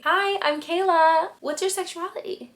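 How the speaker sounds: put-on friendly, girly. Shown as a demonstration. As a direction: introduce yourself way up high like a pal. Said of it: "First one might seem".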